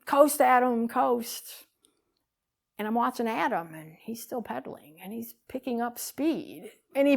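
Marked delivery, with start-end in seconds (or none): 0.1-1.7 raised, higher call down the hill; retold yell. Brother yelling to nephew on his bike, raised call. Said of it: "I hear my brother yell".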